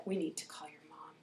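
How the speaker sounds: low, near-whispered concern; grave hushed warning. Retold or imitated. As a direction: break it gently but seriously. Grave adult seeing knee.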